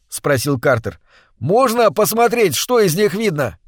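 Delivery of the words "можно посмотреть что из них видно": shouting. A narrator's reading. Shouting over waterfall noise.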